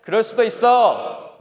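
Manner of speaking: loud, slow rallying chant; shouted slogan. Shown as a demonstration. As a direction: call it out for the crowd to repeat. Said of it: "저랑 한번 같이 따라해 봅시다".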